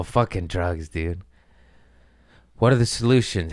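usual speaking voice

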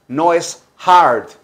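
ordinary talk, nothing marked

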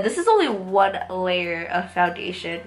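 usual speaking voice

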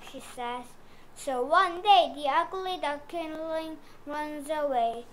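ordinary delivery

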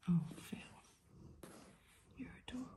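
ordinary talk

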